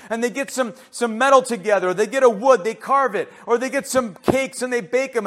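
high, loud, driving pulpit delivery; forceful preaching. Real, not acted. Preacher denounces hand-made false gods, forceful preaching. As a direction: pile up the list with rising urgency.

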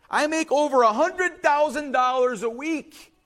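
high-pitched, assured declaration; confident boast. Retold or imitated. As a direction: announce it like it settles everything. Castaway boasts to his panicking friend.

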